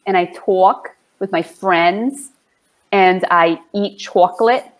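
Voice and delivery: lightly played Long Island New York accent. Shown as a demonstration. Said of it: "but we say".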